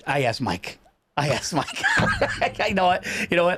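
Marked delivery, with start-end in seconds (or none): 1.9-2.6 sudden laugh. Real, not acted. Laughs at own story.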